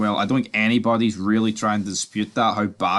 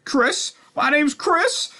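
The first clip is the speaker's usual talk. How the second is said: very high-pitched mock introduction; mock the pundit in a squeaky high voice; mocking silly voice; much slower than usual. Vlogger sending up the pundit introducing himself, mocking high voice.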